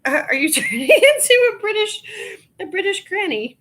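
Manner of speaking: high, giggling teasing question; laughing at mom's crumpets. Retold or imitated. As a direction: tease her through laughter. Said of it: "I was like".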